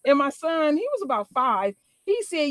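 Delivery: ordinary talk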